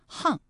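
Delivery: 巷 read as hàng, falling tone. Shown as a demonstration. Host reminds listeners of the other reading, 巷 as hàng. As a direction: say 巷 with an h sound, hàng. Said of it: "还有另外一个读音呢".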